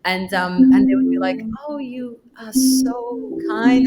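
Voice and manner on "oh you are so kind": slow, lightly voiced old lady, touched; slow grandma voice. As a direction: stretch it out like a grateful grandma. Elderly visitor praising the child.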